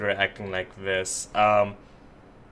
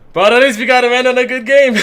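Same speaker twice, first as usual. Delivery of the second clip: sarcastic cheer; 11 semitones higher, 9 dB louder than usual. Acted. Mock upbeat after crash.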